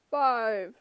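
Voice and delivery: loud, curt giant; monster voice. A narrator's reading. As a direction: snap the number. Cyclops voice.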